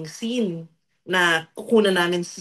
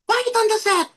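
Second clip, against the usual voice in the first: bossy director call; 13 semitones higher than usual. Pretend. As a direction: play the strict director calling the set to order. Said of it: "acting-acting ako para ano, mapaarte ko". (mahigpit na direktor na sumisigaw)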